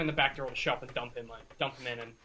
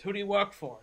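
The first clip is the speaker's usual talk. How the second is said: raised-pitch demanding question; higher, forceful interrogator; insistent interrogation; 6 semitones higher than usual. Acted. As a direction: press the captive harder for an answer. Repeated after slapping him again.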